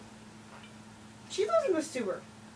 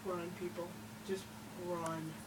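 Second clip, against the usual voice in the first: hushed warning; 7 semitones lower, 16 dB quieter than usual. Acted.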